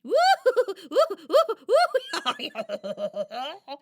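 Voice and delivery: loud, high, trilling hoot; wavering creature call. Acted. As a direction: let out a long wobbly hoot. Mysterious creature cries out.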